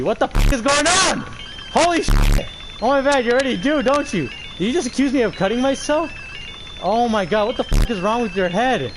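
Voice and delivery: loud, high shocked outburst; shocked yelling. Real, not acted. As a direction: blurt out in disbelief. Shocked at game scene.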